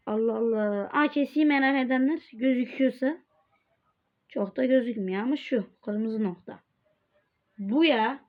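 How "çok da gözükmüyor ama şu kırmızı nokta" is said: usual speaking voice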